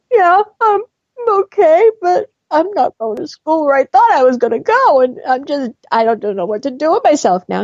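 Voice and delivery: high, tearful voice choking on the words; sobbing out the bad news to a stranger; retold crying. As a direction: speak through tears, voice breaking high. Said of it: "I was just crying and crying".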